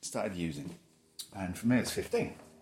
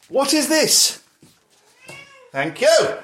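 high sing-song to dog; 13 semitones higher, 12 dB louder, slower than usual. Real, not acted.

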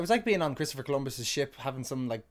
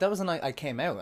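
usual speaking voice

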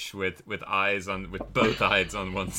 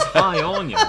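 high, louder strained hi; odd fake hello; 6 semitones higher, 5 dB louder than usual. Shown as a demonstration. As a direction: blurt out a strange hello. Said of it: "sounds like nothing that a human would ever say".

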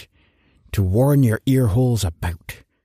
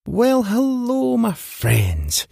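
drawn-out greeting; 10 semitones higher, much slower than usual. Pretend. Host's big drawn-out greeting.

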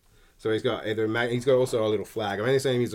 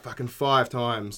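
usual speaking voice

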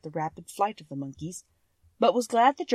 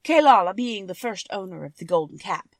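lightly voiced Monkey King telling his tale; 3 semitones higher, 8 dB louder than usual. Monkey King tells Dorothy his history, lightly voiced. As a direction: spin the old tale, lively in places.

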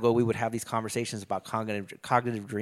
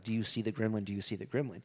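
eerie soft repeat; 2 semitones lower, 9 dB quieter, faster than usual. Retold or imitated. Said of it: "keep telling her".

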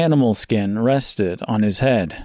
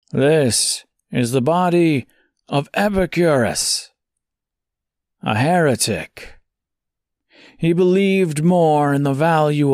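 preaching prophet stranger; 4 semitones higher than usual. A narrator's reading. Stranger explains the heretic.

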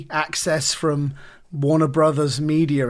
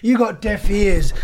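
loud, higher-pitched mock outburst; raised-voice imitation of an angry interviewee; irritated retort; 5 semitones higher, 5 dB louder than usual. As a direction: imitate an annoyed star cutting someone off. Mimicking Russell Crowe snapping in interview.

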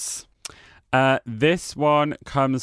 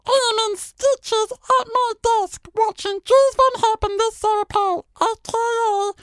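very high squeaky helium voice; slower than usual. Shown as a demonstration. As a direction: read it like helium. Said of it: "[name] in that voice".

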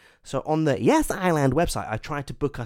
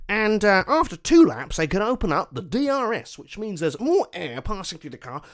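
commentator voice; 7 semitones higher, 3 dB louder than usual. Retold or imitated. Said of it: "they go".

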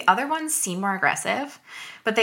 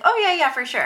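high, hurried mock assent; flustered agreement; 9 semitones higher, 4 dB louder than usual. Imitating people backing down, flustered and high. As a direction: mimic someone backing off quickly.

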